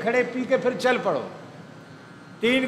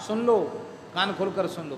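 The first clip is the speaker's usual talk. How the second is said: usual speaking voice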